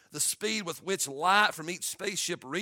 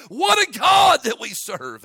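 slow, loud, higher cry of wonder; awed shout; 7 semitones higher, 12 dB louder, slower than usual. Acted. Preacher crying out praise to the congregation, slow and loud. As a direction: shout it out in amazement. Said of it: "stand back with my mouth agape and say".

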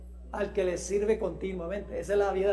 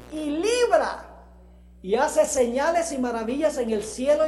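loud, slow scripture proclamation, peaks raised high; full-force preaching; 4 semitones higher, 7 dB louder, slower than usual. Acted. Preacher declaring the king's praise to the congregation, full-force preaching. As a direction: proclaim it forcefully, stretching the key words.

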